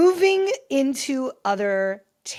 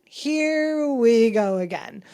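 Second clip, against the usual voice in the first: slow, stretched-out mock exasperation; irritated, drawn out; 3 semitones higher, 5 dB louder, slower than usual. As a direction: drag every word out, imitating someone fed up. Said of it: "very irritated, not even really amused".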